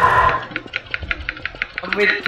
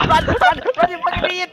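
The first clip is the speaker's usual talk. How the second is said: panicked yelling; 10 semitones higher than usual. Real, not acted. Friend chased by monster.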